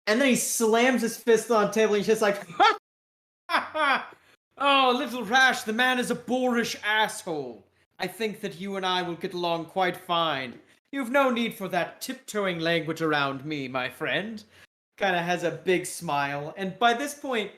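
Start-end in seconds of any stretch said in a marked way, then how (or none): 3.5-4.1 high, short burst of laughter; acted laugh. Character laughs after slamming the table. 4.6-14.4 put-on British accent. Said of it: "with a British accent".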